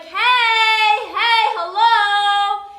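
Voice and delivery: loud, drawn-out high yell of hello; yelling into phone. Retold or imitated. Yelling into the phone at the girls who found it. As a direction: belt it out so the girls hear. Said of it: "I started yelling".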